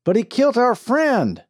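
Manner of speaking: loud, high shout. A narrator's reading. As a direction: yell it across the street. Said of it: "Bill shouted".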